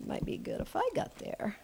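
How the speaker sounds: near-whispered aside, very soft; under the breath while searching; muttering to self. Real, not acted. Preacher to herself while finding the Bible page, muttering.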